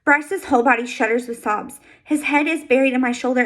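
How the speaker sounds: usual speaking voice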